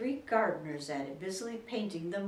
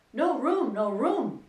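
loud, high cry of no room; 8 semitones higher, 11 dB louder, slower than usual. A narrator's reading. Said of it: "they cried out".

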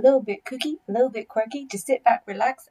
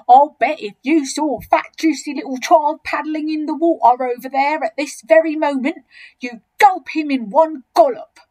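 loud, higher, relishing the bet; enormous crocodile, taunting; 5 semitones higher, 8 dB louder than usual. Acted. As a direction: goad the other croc greedily.

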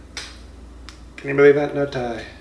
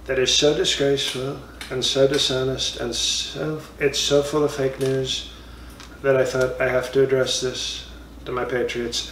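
normal talking voice

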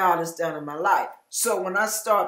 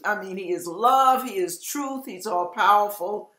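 ordinary talk

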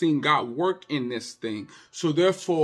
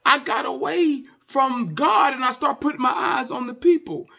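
high, loud, insistent pulpit cry; preaching at full force; 10 semitones higher, 5 dB louder than usual. Real, not acted. Pastor warning the congregation about pleasing people, preaching at full force. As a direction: drive each phrase home at high pitch.

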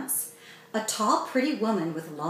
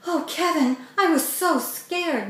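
tearful; 8 semitones higher, 3 dB louder than usual. A narrator's reading. Mandy to her rescued brother, tearful. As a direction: choke it out with relief. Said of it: "with tears in her eyes".

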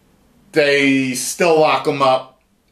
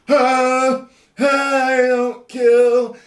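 high, slow whiny Batman; mock the hero's no-kill line; 10 semitones higher, 4 dB louder, much slower than usual. Mocking Batman's no-kill rule.

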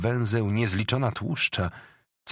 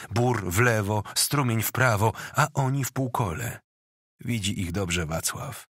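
ordinary delivery, nothing marked

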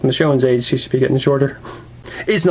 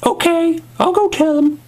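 high falsetto, giving in; Michonne; 13 semitones higher than usual. Acted. Woman agreeing to confess her feelings, high falsetto.

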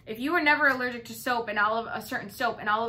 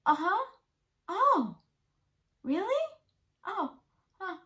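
high, loud mock-sweet listening noises; nodding along sweetly to someone who is wrong; cute fake interest; 10 semitones higher, 3 dB louder than usual. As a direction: play along sweetly and innocently. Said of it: "do this cute face that I do".

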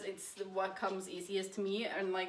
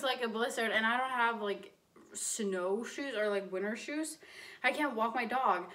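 normal talking voice

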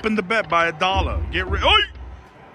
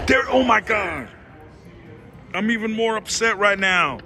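ordinary delivery

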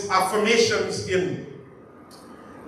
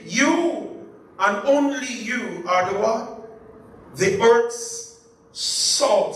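slow emphatic; slower than usual. Shown as a demonstration. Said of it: "as if to say".

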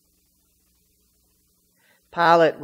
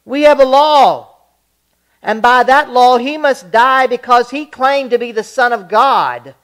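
insistent crowd; 8 semitones higher, 12 dB louder than usual. Acted.

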